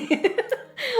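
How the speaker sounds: a bright rising giggle; high giggle. Real, not acted. Vlogger to viewers after admitting she forgot her phone, giggling. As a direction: giggle at yourself. Laughs mid-story.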